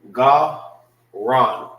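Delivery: "Ga" loud and separate, then "Ran"; syllable split. Shown as a demonstration. Teacher separating syllables, "Ga" punched loud. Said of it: "This is pronounced by itself".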